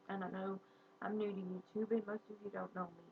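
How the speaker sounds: normal talking voice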